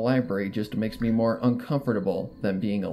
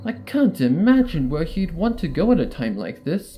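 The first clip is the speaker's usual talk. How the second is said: a touch higher, reassuring pony voice; lightly voiced friend; 4 semitones higher, 7 dB louder than usual. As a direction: promise to find him.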